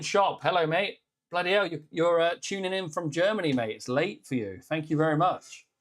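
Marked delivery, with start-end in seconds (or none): none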